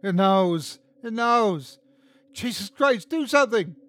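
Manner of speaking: high, rising cry of terror. A narrator's reading. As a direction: plead in panic as it closes in. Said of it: "said with terror".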